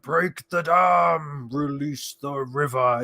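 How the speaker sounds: very slow, drawn-out tree-creature voice; Ent voice. Retold or imitated. Imitating Ents flooding the enemy, very slow booming drawl. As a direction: stretch every word like an ancient walking tree. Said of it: "ending of Two Towers with the ends".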